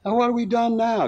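high-pitched, hurt protest; injured innocence. A narrator's reading. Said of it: "Godey asked injuredly".

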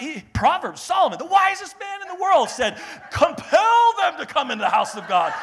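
high, shouted mock proof-texting; heated scripture-quoting. Offended preacher rattling off scriptures at critics, heated shouting. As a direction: hurl the verse as a winning argument, hammed-up. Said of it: "rattling off scriptures".

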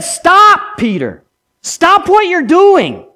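rooster crow as a loud, high warning cry; warning call. Acted. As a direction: call out to stop him. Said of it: "The first crow said".

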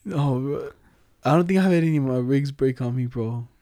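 ordinary delivery

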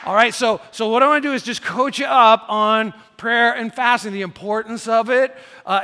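loud, driving sermon voice; preaching at full force. Real, not acted. Pastor fires up the church on fasting, loud and driving.

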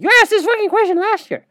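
high mock disbelief from the athlete; Giannis impression. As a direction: mimic an exasperated player. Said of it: "Giannis is like".

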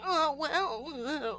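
put-on high, cutesy nerd-boy voice; Joseph Gordon-Levitt. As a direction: play a bashful little nerd. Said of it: "a little cutie nerd boy".

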